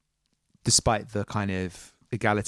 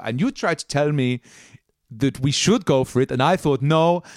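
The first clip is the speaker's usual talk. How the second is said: captain Gruner; 4 semitones higher than usual. Acted. Captain Gruner reminisces with Taryn.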